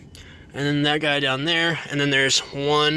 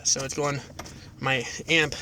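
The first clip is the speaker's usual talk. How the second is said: usual speaking voice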